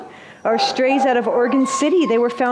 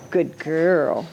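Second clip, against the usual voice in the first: talking to a dog; 7 semitones lower, 3 dB quieter than usual. Real, not acted. Petting the foxhound.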